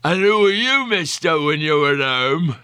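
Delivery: put-on high, leering drunken man; leering drunk reporter voice. A narrator's reading. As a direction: slur it like a boozy hack. Reporter addresses Tammy.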